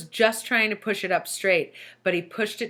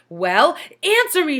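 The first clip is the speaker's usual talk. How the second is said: high loud sharp demand; 9 semitones higher, 7 dB louder than usual. A narrator's reading. Teacher towering over Todd, high loud demand. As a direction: bark it at him from above. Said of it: "she demanded".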